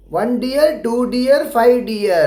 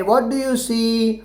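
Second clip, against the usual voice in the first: slow read-aloud question; much slower than usual. Shown as a demonstration. Teacher reading the worksheet question to children, slowly. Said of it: "See मतलब देखना".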